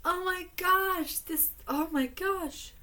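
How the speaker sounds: high, slow oh my gosh; gasping at a photo in disbelief; amazed. Real, not acted. Vlogger reacting to a photo of the band, amazed.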